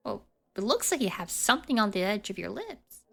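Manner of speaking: ordinary talk, nothing marked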